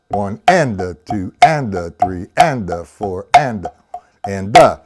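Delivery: slow, low triplet count, each "and" punched; stress on the ANDs. Shown as a demonstration. Drum teacher demonstrates triplet accents, stressing each "and". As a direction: count triplets, hitting every "and". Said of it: "I hit the ands of the triplet".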